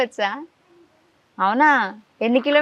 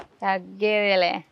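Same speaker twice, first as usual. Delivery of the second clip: slow, drawn-out hero line, a little lower; echoed film catchphrase; 5 semitones lower, 4 dB quieter, much slower than usual. Retold or imitated. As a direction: stretch it out like a punch line. Interviewer echoes child's film catchphrase.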